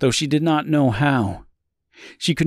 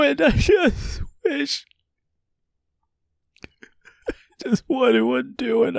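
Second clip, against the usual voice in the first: high, tearful begging; fading into a broken cry; sobbing. A narrator's reading. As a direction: plead for forgiveness through tears. Sobbing apology to the child.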